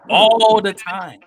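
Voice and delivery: loud, slow stress on all the time; emphatic. Real, not acted. Host insisting to cohost that it always works, loud and slow. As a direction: drive the point home. Emphatic point about Goku.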